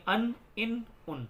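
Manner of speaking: ان said as "an", then "in", then "un"; vowel endings. Shown as a demonstration. Teacher showing the three endings, ان as an, in, un. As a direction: sound out ان three ways: an, in, un. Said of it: "آ کی آواز آئے گی یا ای کی".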